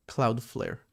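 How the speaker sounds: normal talking voice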